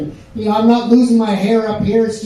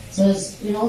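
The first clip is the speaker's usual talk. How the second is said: ordinary talk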